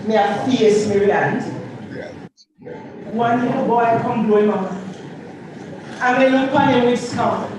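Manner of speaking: rhythmic patois recitation; dub poem. Acted. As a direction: chant it to the beat.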